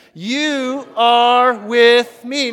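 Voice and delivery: very loud, high, drawn-out; full-voiced rallying declaration; booming call-and-response. Shown as a demonstration. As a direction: shout each word slowly to raise the crowd. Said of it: "I can't hear you over my voice".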